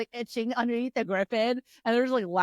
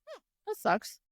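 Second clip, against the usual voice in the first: small, high, soft reaction; tiny high-pitched letdown; mock anticlimax; 13 semitones higher, 5 dB quieter than usual. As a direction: squeak it out softly, underwhelmed. Acting anticlimax after dagger comes out.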